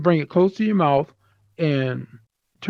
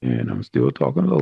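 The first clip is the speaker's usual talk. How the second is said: same low voice, mouth right at the mic; close-miked low voice. Shown as a demonstration. Teacher repeats "I'm still talking low" now close on the mic, clear. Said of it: "now you can hear me a lot better".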